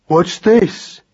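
put-on slow-witted boy's puzzled question; curious child mouse; dim young son, curious. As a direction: ask it high and blunt, like a big simple lad.

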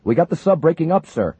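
high, loud excited report; yelling. Acted. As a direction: shout the news. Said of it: "the sonar man yelled".

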